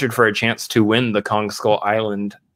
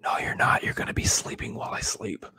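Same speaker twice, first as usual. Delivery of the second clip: whispering; 2 semitones lower, 9 dB quieter than usual. Pretend. Whispered comeback.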